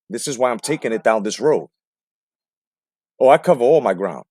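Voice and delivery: ordinary talk, nothing marked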